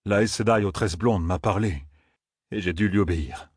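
low, subdued confession; contrite tracker. A narrator's reading. A tracker justifies his betrayal to his victims, contrite and low. Tracker goes on excusing himself.

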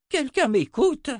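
very high, loud, drawn-out outburst; exploding. A narrator's reading. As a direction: explode at being ignored. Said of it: "explosa Zed en se relevant".

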